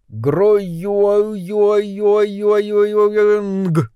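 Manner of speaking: drawn-out wobbling high ringing noise; imitated plate spinning. A narrator's reading. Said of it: "с характерным для столь удручающих обстоятельств звуком". (звукоподражание катящейся тарелке)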